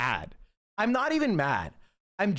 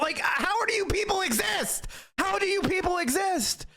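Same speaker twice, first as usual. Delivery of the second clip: exasperated shout; 11 semitones higher than usual. Real, not acted. Ranting at his enemies.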